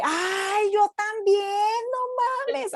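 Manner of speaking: high, exaggerated Tijuana norteño accent; northern Mexican accent. Waitress from Tijuana greeting a fellow Mexican, exaggerated norteño accent. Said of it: "así con el acento".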